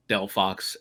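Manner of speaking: usual speaking voice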